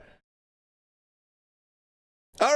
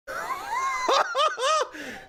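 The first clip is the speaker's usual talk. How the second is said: excited laugh. Real, not acted. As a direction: laugh out, thrilled. Laughing at knockdown.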